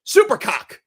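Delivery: high, loud headline reveal; punchy teaser read; headline announcement. Real, not acted. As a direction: announce it like a tabloid. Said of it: "the fun headline I have".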